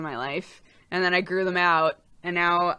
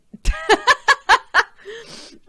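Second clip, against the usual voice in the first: high cackling laugh; high loud laugh; 9 dB louder than usual. Real, not acted. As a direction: burst out laughing. Laughs at being called young.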